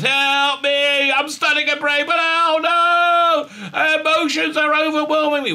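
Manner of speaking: high-pitched drawn-out melodramatic wail; mock panic. Woman seeing monsters on the planet, hammed-up panic. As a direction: cry for help in fake hysteria. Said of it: "she starts freaking out".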